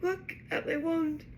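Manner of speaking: high, upset wail without volume; plaintive, shaky and high-pitched. A narrator's reading. Ron shows Harry the snapped wand, shaky. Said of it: "in a shaky voice".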